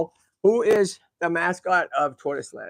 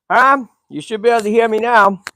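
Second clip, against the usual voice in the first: loud, raised-pitch check for listeners; calling out; 5 semitones higher, 9 dB louder than usual. Real, not acted. Streamer checking viewers can hear him, calling out loudly. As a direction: call out to check they hear you.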